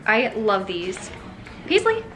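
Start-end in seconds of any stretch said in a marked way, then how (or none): 1.7-2.0 high sing-song voice for the dogs. Real, not acted. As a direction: coo high at the pups like they're babies. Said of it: "my obnoxious dog voice".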